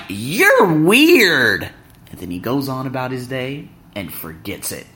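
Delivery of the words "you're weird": loud, high, drawn-out retort; imitating a weirded-out stranger; mock dismissive passerby. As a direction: stretch it out, high and incredulous. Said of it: "he's like".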